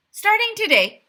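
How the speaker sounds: high, loud proclamation; mayor's pompous announcement; grand announcement. A narrator's reading. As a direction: announce it like a decree. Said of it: "he announced".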